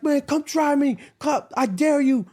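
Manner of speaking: high, mock-tough dare; daring challenge. Customer daring the cashier over napkins, high mock-tough dare. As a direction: taunt him to start something. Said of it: "the other guy's like".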